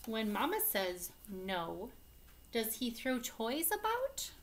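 lively, loud picture-book reading with big swings; teacher reading an angry-dinosaur story to the class, animated; reading to children. Acted. Teacher reads picture book aloud.

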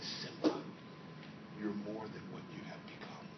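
whispered, low father's voice from beyond; ghostly whisper. Acted. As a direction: breathe it like a ghost to his son. Ghost of Mufasa speaks to son.

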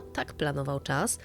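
plain reading voice

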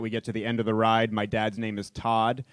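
ordinary talk

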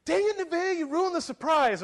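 high, loud mock kid outburst; imitating exasperated child. Son to his sister who ruined the surprise, mock exasperated yell. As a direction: scold a sibling in a high child's voice. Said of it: "And Caleb goes".

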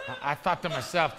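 ordinary talk

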